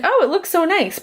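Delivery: high, bright-eyed mock praise; a high, airy imitation of an impressed fan; mock admiring gush. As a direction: gush over it like an impressed viewer. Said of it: "And you'll probably think".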